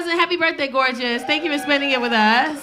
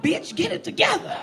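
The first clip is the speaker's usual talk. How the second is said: self pep talk; 4 semitones higher than usual. Retold or imitated. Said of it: "I was like".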